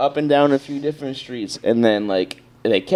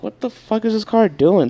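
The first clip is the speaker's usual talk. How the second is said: higher, incredulous outburst; exasperated disbelief; 8 semitones higher than usual. Retold or imitated. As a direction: throw the question up in disbelief. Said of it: "I'm like".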